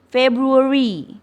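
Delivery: "February" said slowly and clearly as "februri"; model pronunciation. Shown as a demonstration. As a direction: spell out "February" slowly for the class. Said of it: "Perhatikan contoh dari saya". (ucapkan "February" pelan dan jelas)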